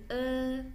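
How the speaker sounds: "E" as a long schwa, "uh"; drawn-out "E" pronounced like "er" without the r; Mandarin e sound. Shown as a demonstration. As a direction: hold "E" out high as a schwa, not "eh". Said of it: "tapi bacanya bukan E".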